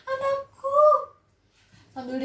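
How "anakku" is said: put-on high, pleading old woman; old mother's voice. Mother hugging her lost son, high pleading. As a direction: reach for your son, voice lifted high. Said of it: "katanya gitu".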